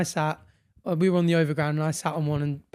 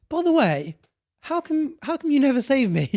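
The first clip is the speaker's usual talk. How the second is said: indignant question; 8 semitones higher than usual. Retold or imitated. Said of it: "and he goes".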